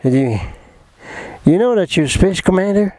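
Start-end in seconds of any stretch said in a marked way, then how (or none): 1.5-2.9 put-on English accent. Englishwoman tells him he is Space Commander, poorly imitated English accent. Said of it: "Avec l'accent anglais".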